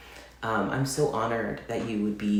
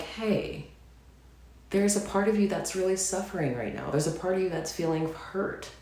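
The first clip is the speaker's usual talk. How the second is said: gentle, soothing self-talk, pitched higher; calming, soothing voice; 9 semitones higher than usual. Retold or imitated. To her hurting self, in a soothing, calming voice. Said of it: "in this calming, soothing voice".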